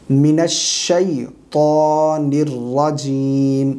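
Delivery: الشیطان very slow, with a full "shay" glide; corrected recitation. Shown as a demonstration. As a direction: draw out "shay" in الشیطان and hold each long vowel. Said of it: "من الشے نہیں پڑھیں گے".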